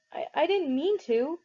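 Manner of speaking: slow, high stammered plea; pleading. A narrator's reading. Pony pleading with angry older brother, stammering. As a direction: beg him to understand. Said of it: "I pleaded with Derry".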